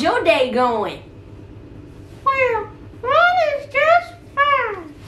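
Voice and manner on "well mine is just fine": high, slow puppet voice. Pretend. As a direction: play a cheerful little puppet.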